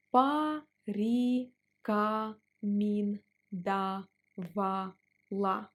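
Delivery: порекомендовала drawn out slowly. Shown as a demonstration. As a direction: stretch порекомендовала syllable by syllable. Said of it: "Let's pronounce it slower".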